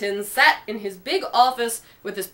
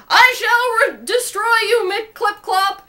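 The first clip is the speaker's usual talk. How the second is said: high, loud howl of vengeance; villain howling revenge; howled threat; 9 semitones higher, 3 dB louder, slower than usual. A narrator's reading. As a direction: howl it at the escaping submarine. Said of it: "howled Rex".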